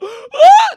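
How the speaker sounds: loud high gasp of joy; huge excited gasp. Retold or imitated. Kid hearing they're going to Disneyland, a loud gasp. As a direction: gasp like the best news ever.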